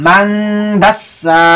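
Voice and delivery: মাংদাসা with a long hummed "ng" and a drawn-out final সা; ghunnah and long vowel. Shown as a demonstration. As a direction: recite মাংদাসা slowly, holding the nasal. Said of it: "এক আলিফ পরিমাণ লম্বা করতে হবে".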